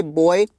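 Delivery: put-on बॉय said slowly and clearly as boy; demonstrating pronunciation. Shown as a demonstration. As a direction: sound out बॉय carefully for students. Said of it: "कुछ इस तरीके से".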